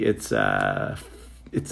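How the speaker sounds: ordinary delivery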